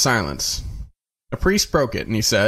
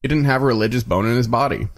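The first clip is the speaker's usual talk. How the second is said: ordinary delivery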